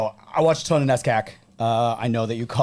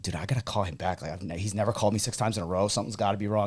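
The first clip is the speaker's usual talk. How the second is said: hushed aside; 5 semitones lower, 8 dB quieter, faster than usual. Retold or imitated. Coach murmuring to head coach.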